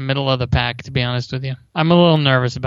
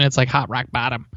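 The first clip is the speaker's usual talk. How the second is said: ordinary talk, nothing marked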